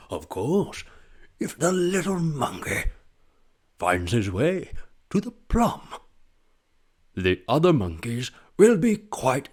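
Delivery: lightly voiced hushed nature narrator; a hint of Attenborough, wandering pitch; David Attenborough impression. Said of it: "I do have the David Attenborough impression".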